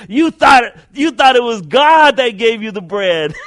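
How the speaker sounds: loud taunt through laughter; mocking, laughing. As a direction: jeer at her while laughing. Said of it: "young man's laughing".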